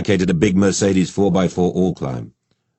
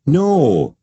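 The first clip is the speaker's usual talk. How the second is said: high-pitched, drawn-out indignant denial; stung, higher-voiced objection; protesting; 9 semitones higher, 4 dB louder than usual. A narrator's reading. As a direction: protest your innocence. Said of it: "Ian protested".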